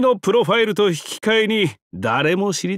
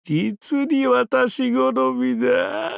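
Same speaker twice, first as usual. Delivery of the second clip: gloating cackle; 7 semitones higher, much slower than usual. Acted. Relishing, then cackling.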